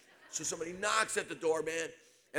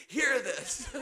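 laughing; 6 semitones higher than usual. Real, not acted. Preacher repeats line laughing.

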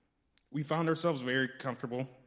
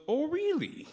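put-on Minnesotan accent; 5 dB louder, slower than usual. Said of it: "thick Minnesotan accent".

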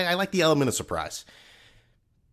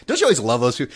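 ordinary talk, nothing marked

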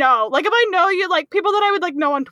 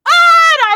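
sudden high, loud shriek of panic; panicked scream; 10 dB louder than usual. Pretend. Shy fan panicking at a convention, high loud shriek. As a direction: yelp like you've just been cornered. Panicking when meeting people.

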